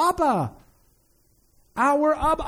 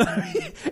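breathy laugh; 6 dB quieter than usual. Real, not acted. Laughing at his own joke.

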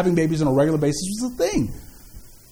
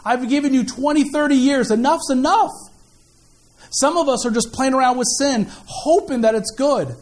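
preaching appeal; 5 semitones higher than usual. Acted. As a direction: drive the call home from the pulpit.

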